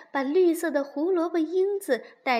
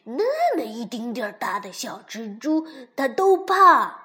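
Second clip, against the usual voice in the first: put-on loud, excited tattling child; telling tales; 2 semitones higher, 9 dB louder than usual. A narrator's reading. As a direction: tell on your friend with glee. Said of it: "向熊婆婆揭发". (大声兴奋地揭发告状)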